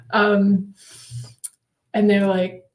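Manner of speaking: usual speaking voice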